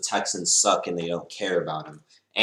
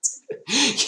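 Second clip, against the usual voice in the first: breathy laugh. Real, not acted. Laugh after mocking quote.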